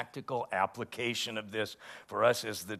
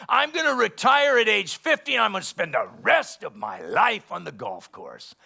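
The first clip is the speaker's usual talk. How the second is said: much higher, louder mocking voice of a boastful retiree; mock smug planner; 8 semitones higher, 9 dB louder than usual. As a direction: lampoon the man bragging about retiring.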